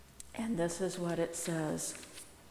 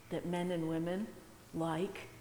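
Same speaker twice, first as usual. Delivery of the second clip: ordinary talk, nothing marked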